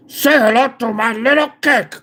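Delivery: Tony Montana line in a put-on gangster voice; Al Pacino impression. Streamer does a Tony Montana cake quote, put-on gangster voice. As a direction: growl it like Scarface. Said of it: "Did I just nail an Al Pacino".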